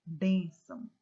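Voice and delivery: BÊN punched loud in "Bênção"; stress on penultimate syllable. Shown as a demonstration. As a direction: hit the first syllable of "Bênção" hard, drop the ending. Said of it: "Olha como você pronuncia com mais intensidade".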